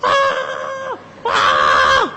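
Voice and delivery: low, drawn-out mock cry from the tower; imitated yell. Preacher mimics the boy yelling from the mosque dome, low drawn mock cry. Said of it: "teriak-teriak, saudara".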